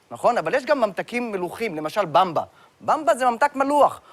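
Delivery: ordinary talk, nothing marked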